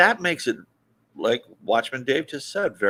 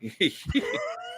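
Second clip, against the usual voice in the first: shrieking laugh; 6 dB quieter than usual. Real, not acted.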